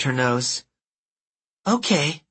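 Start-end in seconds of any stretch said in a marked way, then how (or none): 1.7-2.2 put-on bossy nasal girl. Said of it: "bossy nasal voice".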